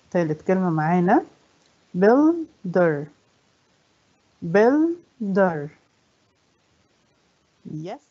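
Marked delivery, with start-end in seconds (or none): 2.0-3.1 "builder" split as buil, pause, der; syllable by syllable. Shown as a demonstration. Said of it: "كم عدد المقاطع". 4.4-5.7 "builder" split as buil, pause, der; syllable by syllable. Shown as a demonstration. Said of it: "كم عدد المقاطع".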